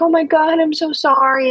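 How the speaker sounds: mock groveling. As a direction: ham up a desperate crier. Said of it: "where they were like".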